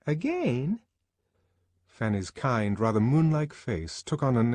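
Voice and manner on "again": high, quick, chattering woman's voice; a fussy friend prattling on; gossipy Fanny. Acted. Fanny disapproving.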